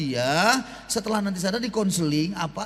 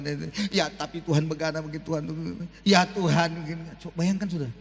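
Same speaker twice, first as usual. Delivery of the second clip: doubting prayer; 6 semitones lower than usual. Shown as a demonstration. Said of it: "saya sedang menunjukkan saya tidak percaya".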